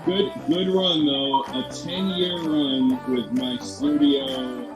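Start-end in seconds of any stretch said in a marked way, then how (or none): none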